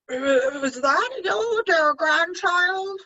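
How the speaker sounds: high, slow false granny voice; a wolf faking a sweet old lady; wolf imitating granny. Wolf in Granny's bed luring the girl, high and slow. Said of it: "imitating Granny's voice as much as possible".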